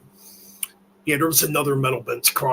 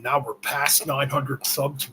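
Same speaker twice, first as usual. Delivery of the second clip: ordinary talk, nothing marked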